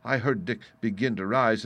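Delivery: usual speaking voice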